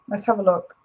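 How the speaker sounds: ordinary talk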